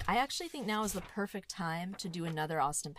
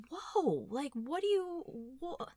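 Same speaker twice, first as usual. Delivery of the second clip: baffled viewer; 7 semitones higher, slower than usual. Retold or imitated. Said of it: "And you're like".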